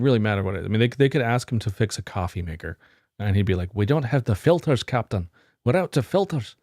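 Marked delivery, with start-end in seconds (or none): 3.7-6.6 hammed-up bad Scottish accent, rising higher at the end; lightly put-on bad Scottish accent, pleading with the Captain; bad Scottish accent as Scotty. Scotty telling the Captain he can't fix the coffee maker, in a bad Scottish accent. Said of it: "That's a, that's a bad Scottish accent".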